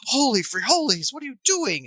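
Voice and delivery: high, loud stunned cry; startled high exclamation; shocked kid. Retold or imitated. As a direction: kid gaping at the parent's meltdown. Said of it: "They're like".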